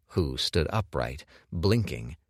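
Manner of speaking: ordinary delivery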